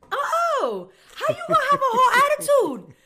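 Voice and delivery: very high, loud squawk of mock indignation; hammed-up high-pitched holler; mocking outcry. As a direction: shriek it in exaggerated disbelief, half laughing. Mocking his attitude in the story.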